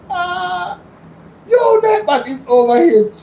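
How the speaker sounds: laughing it out, high and amused. Real, not acted. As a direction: burst out laughing at the bot. Laughing at misbehaving chat bot.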